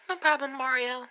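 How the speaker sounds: high, dainty princess voice; lightly lilting high character voice; Princess Peach, obliging. Acted. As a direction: answer sweetly as a cartoon princess. Princess Peach answers Mario.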